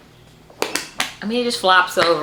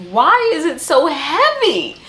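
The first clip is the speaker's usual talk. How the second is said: exasperated outcry; 8 semitones higher, 5 dB louder than usual. Real, not acted. Lifting a huge heavy book.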